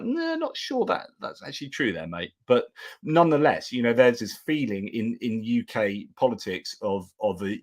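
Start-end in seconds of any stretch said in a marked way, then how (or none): 0.0-2.3 higher, softer mock-doubtful reply; lightly voiced doubt, a touch of sarcasm; gently deflate the boast, much higher; sceptical retort. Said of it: "You're like".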